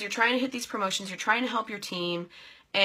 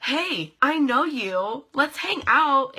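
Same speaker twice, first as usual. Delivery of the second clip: friendly loud greeting; 6 semitones higher, 7 dB louder, much slower than usual. Retold or imitated. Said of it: "It was like".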